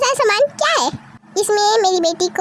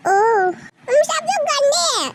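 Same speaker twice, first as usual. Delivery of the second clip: put-on slow sulking child; 5 semitones higher, slower than usual. Child sulks at family.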